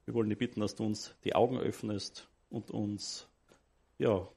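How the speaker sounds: low, soft, reverent prayer. Acted. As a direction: pray softly and humbly. Leads the congregation in prayer.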